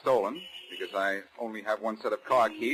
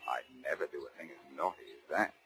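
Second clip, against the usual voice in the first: dropped low and soft, teasing prim denial; mock innocence; 9 semitones lower, 10 dB quieter than usual. Blackie teasing the inspector about fleeing, mock-innocent murmur. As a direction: play the naughty schoolboy, tongue in cheek.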